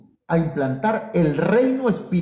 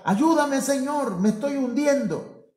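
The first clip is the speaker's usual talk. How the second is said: much higher, pleading cry of a drowning man; higher, half-hammed plea for help; acted cry for help; 8 semitones higher than usual. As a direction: cry out to the Lord as if sinking. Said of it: "Como cuando Pedro gritó".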